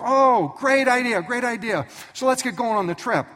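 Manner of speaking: put-on high, loud gushing kid; excited boy. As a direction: jump at the idea like a happy boy. Isaac excited about camping.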